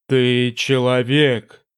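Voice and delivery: low, slow, echoing demon voice; a vast presence speaking from the dark; booming demon voice. Acted. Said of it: "громыхнул в голове жреца гулкий голос".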